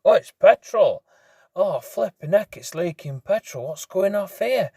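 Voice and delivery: high, startled exclamations; retold alarm. Biker discovering leaking petrol, high alarmed exclamations. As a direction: act out the shock of finding a leak. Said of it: "Put my hand".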